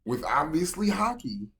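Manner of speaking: loud, high hype; excited. Real, not acted. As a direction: shout it like it's obvious. Hype reaction to his theory.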